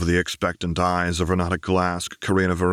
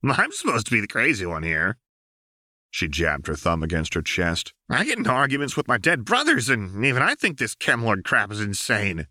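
put-on much higher, fast, sarcastic young woman; Jinx's mocking voice; 4 semitones higher than usual. A narrator's reading. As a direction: play it bratty and incredulous. Jinx protests nervously.

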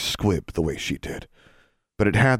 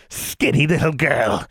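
high, slow scornful witch; disdainful hag; 8 semitones higher than usual. Acted. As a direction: sneer at a catch too small. Hag holding girl.

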